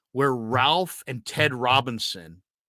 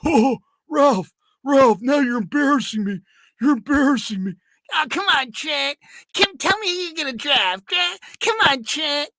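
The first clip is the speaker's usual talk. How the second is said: much higher, louder teasing voice; Ralph in the bit; 14 semitones higher, 5 dB louder than usual. Retold or imitated. Ralph pressing Trent on his draft pick, much higher and louder teasing. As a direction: coax and needle him. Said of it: "Different tones, different voice inflections".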